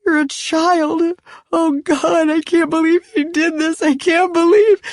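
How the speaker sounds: high, broken, tearful voice; sobbing. Acted. Betrayed wife realizing the girl is young, sobbing. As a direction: let the words crack through sobs. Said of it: "she started sobbing".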